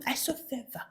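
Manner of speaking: whispered mock tattling; hushed church-gossip imitation; conspiratorial whisper. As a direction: leak it in a secretive whisper. Informant confides gossip to mother.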